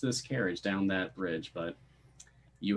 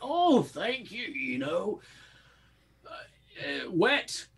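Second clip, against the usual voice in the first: high, halting character voice; Shlum, touched then forlorn; 12 semitones higher than usual. Acted. Driver asked about his day, high halting reply. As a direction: answer high, hesitating before the last word. Game master answers as Shlum.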